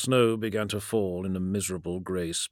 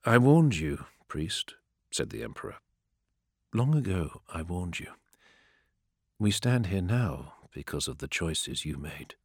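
lower, softer, measured emperor; a lightly voiced cold emperor, lower and softer; calm emperor; 3 semitones lower, 3 dB quieter than usual. A narrator's reading. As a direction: speak as an unmoved ruler passing sentence.

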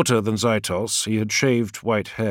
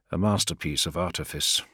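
ordinary delivery

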